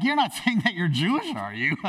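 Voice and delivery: high, fast question with nervous laughter; nervous disbelief. Acted. Haman nervously questioning Esther.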